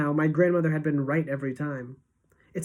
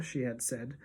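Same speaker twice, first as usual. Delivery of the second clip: ordinary delivery, nothing marked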